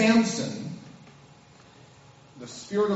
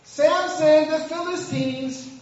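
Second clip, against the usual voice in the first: high, loud call of alarm; 8 semitones higher, 4 dB louder, slower than usual. Retold or imitated. Delilah crying out to Samson, high alarmed call. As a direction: shout the warning to wake him. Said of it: "Cut him off".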